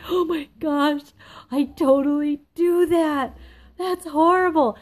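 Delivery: high, drawn-out, loud mock dismay; horrified realization. Admitting your flaw a week later, acted horrified realization. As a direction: gasp it out, aghast at yourself.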